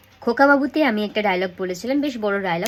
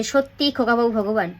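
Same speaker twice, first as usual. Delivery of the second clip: brisk everyday voice, words run together; wrong, run-on delivery. Shown as a demonstration. Teacher shows students how not to say it, run-on delivery. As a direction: say it straight through without a pause. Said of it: "এভাবে তো বলতে পারবো না".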